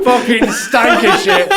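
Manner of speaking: high, slow words through laughter; laughing. Real, not acted. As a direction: say it while cracking up. Laughing while telling.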